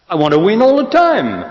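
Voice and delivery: a spoiled self insisting it must win; whiny greedy self. Pretend.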